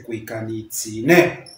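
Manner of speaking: cuicanitzine, slow, stress on the final syllable "ne"; teacher stretches cuicanitzine, landing on the last syllable. Shown as a demonstration. Teacher demonstrates ablative stress for students, slowly and deliberately. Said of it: "ahí la acentuación va en la última".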